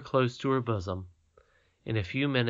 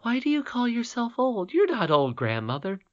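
put-on higher, indignant young princess; protesting little girl; 12 semitones higher, 4 dB louder than usual. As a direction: insist she is not old. Irene protests.

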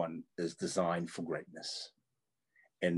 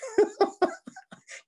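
high-pitched burst of laughter; real, squealing high laugh. Real, not acted. Laughs at her own anecdote.

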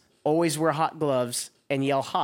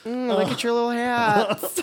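cooing voice; 10 semitones higher, 4 dB louder than usual. Pretend. Cooing at cartoon figures.

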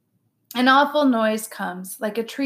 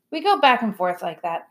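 plain reading voice

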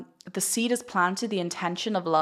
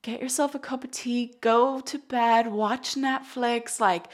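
soothing friend; 5 semitones higher, slower than usual. Retold or imitated. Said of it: "you'd be like".

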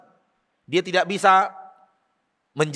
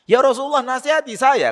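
raised, high-pitched entreaty; insistent plea; 8 semitones higher, 4 dB louder than usual. Retold or imitated. A man asks the Prophet for advice, insistent plea. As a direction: plead higher, as if asking once more.

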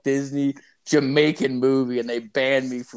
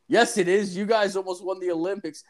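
laughing; 8 semitones higher than usual. Retold or imitated. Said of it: "I'm like".

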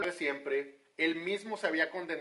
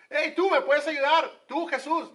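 loud call; 11 semitones higher, 9 dB louder than usual. Acted. Quoted casual plea.

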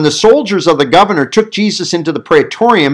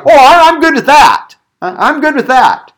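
mock easygoing approval, high and loud; breezy agreement; 11 semitones higher, 5 dB louder than usual. People answering the preacher's claim that Jesus is king, breezy agreement. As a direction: play people happily going along with it. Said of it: "they're like".

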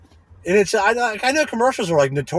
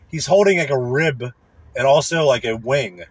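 normal talking voice

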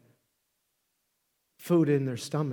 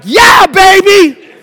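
slow, high, loud drawn-out yell; proud cheer; 13 semitones higher, 19 dB louder, much slower than usual. Retold or imitated. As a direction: crow with delight at your son. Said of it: "And I was like".

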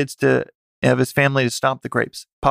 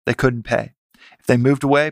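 ordinary delivery

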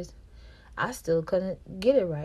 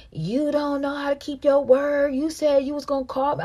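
raised, higher voice scolding her; mock angry boyfriend; 8 semitones higher, 7 dB louder than usual. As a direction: imitate him snapping about the phone call. His angry complaint retold.

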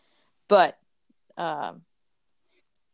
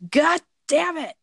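loud, high, slow frustrated curse; exasperated outburst; 11 semitones higher, 6 dB louder, slower than usual. Retold or imitated. To wife after forgetting the water, a loud, exasperated curse. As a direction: blow up at yourself for forgetting.

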